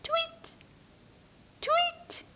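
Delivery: very high, soft tweet; tiny high birdsong; bird tweet. Shown as a demonstration. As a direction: chirp like a little bird. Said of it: "A bird".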